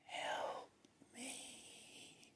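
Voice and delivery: whispered, drawn-out cry for help; barely audible, like screaming from inside a soundproof box; faint muffled scream. Retold or imitated. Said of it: "were screaming at the top of their lungs, but I could barely hear them".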